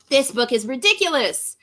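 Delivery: ordinary delivery, nothing marked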